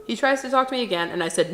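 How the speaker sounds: ordinary delivery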